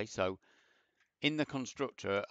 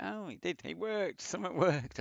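delighted surprise; 9 semitones higher than usual. Real, not acted. Code unexpectedly works.

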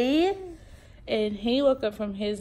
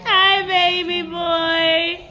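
high, loud cooing at the babies; bubbly high mom voice to her babies; 8 semitones higher, 6 dB louder, slower than usual. Real, not acted. Baby talk to the twins.

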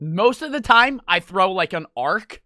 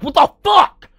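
mock outraged yell; 8 semitones higher, 6 dB louder than usual. Kid hit by bottle reacting.

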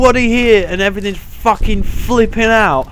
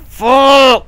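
startled curse; 6 semitones higher, 7 dB louder than usual. Real, not acted. Sudden scare in horror game.

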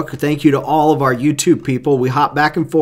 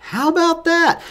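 pleasantly surprised; 11 semitones higher than usual. Real, not acted. Host reacts to viewer's news.

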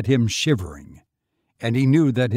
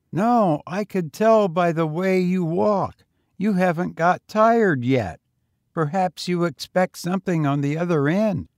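put-on higher, eager boy voice; young boy; 7 semitones higher, 5 dB louder than usual. A narrator's reading. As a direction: pitch up for a street boy. Boy talks.

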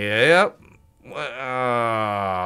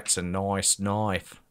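mock Australian accent; 4 semitones lower, 6 dB quieter than usual. Slides into Australian film quote.

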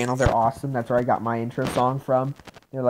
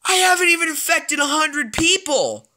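outraged; 12 semitones higher than usual. Real, not acted. Outraged at the cure progress.

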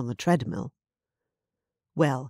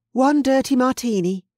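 a hint of a brisk bartender, higher; lightly voiced barmaid calling out an order; bartender Nessa; 9 semitones higher, 6 dB louder than usual. Bartender serves a drink, lightly voiced brisk barmaid.

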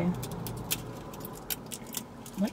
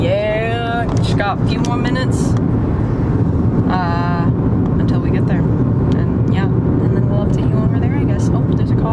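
loud upbeat vlog voice; vlog presenter; 8 dB louder than usual. Acted. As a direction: talk to the viewers. Vlog intro to the camera.

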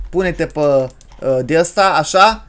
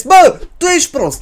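high, loud scolding outburst; exasperated shout; 11 semitones higher, 6 dB louder than usual. Pretend. Player scolding the disobedient baby, high loud exasperation. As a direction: snap loudly at a disobedient child.

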